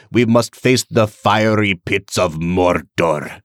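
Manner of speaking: slow, over-enunciated Elrond voice with rolled R's; Hugo Weaving impression. Fan imitating the actor at the Council of Elrond, rolled R's and slow. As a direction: lean into each word, rolling the R's grandly. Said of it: "he rolls his R's and stuff".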